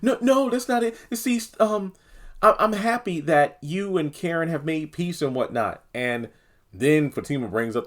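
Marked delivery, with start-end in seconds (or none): 0.0-5.8 high-pitched, backpedalling voice; high, hesitant mock reassurance; flustered denial. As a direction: mimic someone dodging, pitched way up. Acts out Andy dodging the questions.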